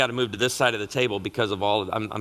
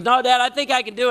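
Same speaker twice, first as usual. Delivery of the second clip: put-on high, fast, louder girl's voice; daughter pleading; 13 semitones higher, 6 dB louder, faster than usual. Daughter insisting to dad she can jump. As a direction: insist like a bold young daughter. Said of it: "She goes".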